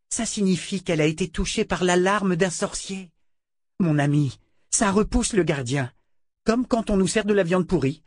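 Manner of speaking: high-pitched, excited rush of delight; elated. A narrator's reading. Scholar telling his friend the best news in years, elated. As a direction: pour out the good news, overjoyed.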